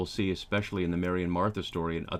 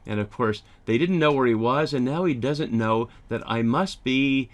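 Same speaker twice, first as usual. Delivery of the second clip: normal talking voice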